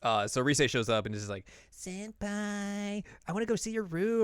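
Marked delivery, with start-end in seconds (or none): 1.7-4.2 put-on very high, girlish pleading; cute high voice. Rise asking to see senpai's room, high and cute. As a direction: wheedle like a cute junior.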